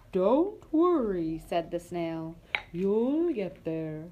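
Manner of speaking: slow, drawn-out snail; very slow slimy snail voice. Acted. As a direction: ooze each word out slowly.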